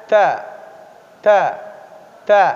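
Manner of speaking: ta with the tongue touching but not pressing; touch lightly, repeat; letter drill. Shown as a demonstration. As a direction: tajwid drill. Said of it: "Tapi kena ya".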